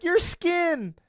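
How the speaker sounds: put-on very high, innocent kid voice; imitated little daughter. Daughter answering her dad in I Spy, high mimicked child voice. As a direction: pipe up high like a child guessing.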